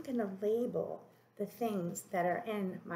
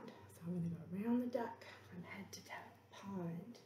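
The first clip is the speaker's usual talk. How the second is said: softly whispered, fast; 9 dB quieter than usual. Real, not acted. Teacher whispering to herself while writing.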